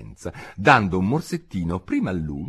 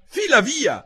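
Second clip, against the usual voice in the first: high, loud order to clear off; high, loud and cross; indignant dismissal; 6 dB louder than usual. A narrator's reading. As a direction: shoo the bird away sharply. Alice shooing the pigeon away.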